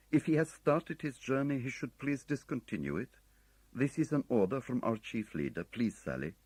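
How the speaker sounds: polite but desperate, a man under fire; courtesy under fire. A narrator's reading. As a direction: foreign officer urging a warning, strained courtesy. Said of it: "the unnatural courtesy of a man under fire".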